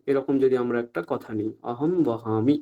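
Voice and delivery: ordinary talk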